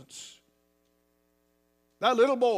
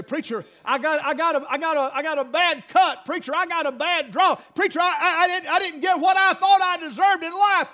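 louder, higher whining complaint; mock-whiny churchgoer griping about life; complaining congregant; 9 semitones higher, 9 dB louder than usual. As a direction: plead your grievance to the preacher. Congregant's complaint voiced by preacher.

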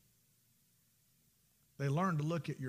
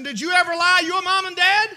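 usual speaking voice

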